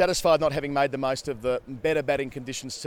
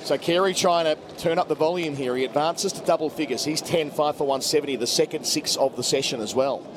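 cricket commentator; 4 semitones higher than usual. Real, not acted. Live call building to wicket.

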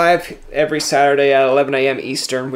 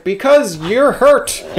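slow, higher, louder sincere reply; earnest concern; 10 semitones higher, 5 dB louder, much slower than usual. Retold or imitated. Scorer telling the hurt goalie why he stayed, slow earnest concern. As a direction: say it slowly as if it's obvious.

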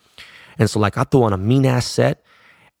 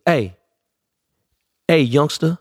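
Dre; 5 semitones higher, slower than usual. Retold or imitated. Dre greets him at bar.

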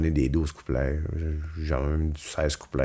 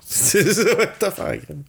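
laughing it out, much higher; 12 semitones higher than usual. Real, not acted. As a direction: laugh through the words. Repeats it laughing.

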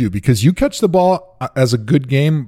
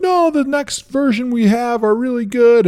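mock company rep; 11 semitones higher than usual. Under Armour reply quoted.

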